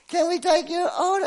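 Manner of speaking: high, put-on voice of the order-taker; drive-thru worker. Preacher imitating the drive-thru worker in a high put-on voice. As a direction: squeak it out like the voice on the drive-thru speaker.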